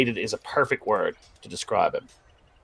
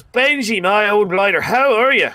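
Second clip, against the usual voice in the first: much higher and louder, bellowing a friendly hello; hearty loud greeting; 10 semitones higher, 6 dB louder than usual. Acted. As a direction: boom it out to an old mate. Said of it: "He goes".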